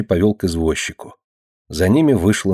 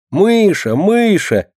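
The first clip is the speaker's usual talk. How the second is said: very high, teasing singsong; mocking; 11 semitones higher, much slower than usual. A narrator's reading. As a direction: tease your sister with the nickname. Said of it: "передразнила она". (дразнящий высокий голос)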